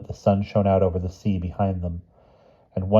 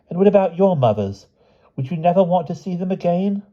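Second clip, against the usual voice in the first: put-on high, pleading child character voice; worried Charlotte; 11 semitones higher, 3 dB louder than usual. A narrator's reading. Charlotte pleading with the other children about their families, worried. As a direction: raise the pitch and press the anxious questions.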